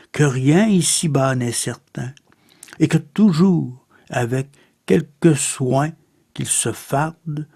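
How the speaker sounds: poetry recitation. Acted. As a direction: recite it in short phrases, pausing between images. Recites a Baudelaire poem.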